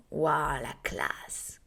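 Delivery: low, breathy, impressed whisper; hushed awe at someone classy; whispered admiration. Acted. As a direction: let the wow fade into a whisper. Acts admiring another person.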